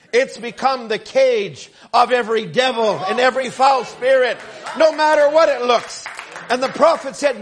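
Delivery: preacher building in force; forceful preaching. Real, not acted. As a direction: press it home to the congregation.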